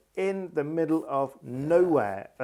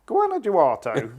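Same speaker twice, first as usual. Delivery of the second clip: put-on Guanajuato anglicised, higher and slow in a posh Surrey accent; 7 semitones higher, 5 dB louder, slower than usual. Imitating late friend Martin saying Guanajuato, Surrey accent. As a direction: mimic an English journalist mangling Guanajuato. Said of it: "in his very Surrey accent".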